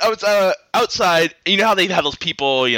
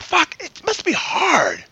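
mock audience member; 11 semitones higher, much slower than usual. Imagined audience member's comment.

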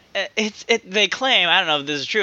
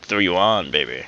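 much lower, sultry tease; seductive voice; 10 semitones lower than usual. Acted. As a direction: purr it like a lover. Joking innuendo reply.

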